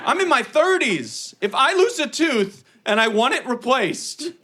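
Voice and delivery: high, indignant comic rant; mock outraged yelling. Bookseller yelling at little girl over tooth money, mock outrage. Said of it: "Started yelling at her".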